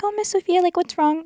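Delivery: put-on high, small child's voice; a child's high voice asking what's wrong; little student. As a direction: play the worried little girl asking. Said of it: "one of my students was like".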